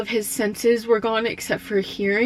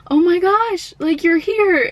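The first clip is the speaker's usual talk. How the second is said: high, loud delighted gasp of surprise; surprised; 10 semitones higher, 8 dB louder than usual. Retold or imitated. Dad seeing his daughters at his bedside, surprised and overjoyed. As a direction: burst out in happy disbelief. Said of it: "like, all surprised again".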